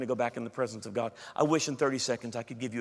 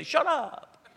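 blunt shut up; 12 semitones higher than usual. Retold or imitated. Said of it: "Or, no, he'll be kinder".

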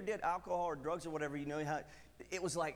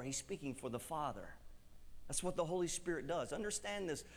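ordinary delivery

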